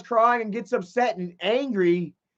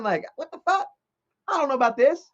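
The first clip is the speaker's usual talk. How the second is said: baffled; 6 semitones higher, faster than usual. Real, not acted. Baffled by her advances.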